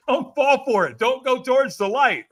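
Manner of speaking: high-pitched, hammed-up warning; mock pleading. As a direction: plead with the crowd in mock alarm. Mock warning to the crowd, retold.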